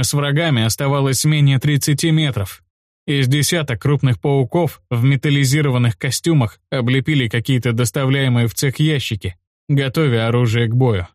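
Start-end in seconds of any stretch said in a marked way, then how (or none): none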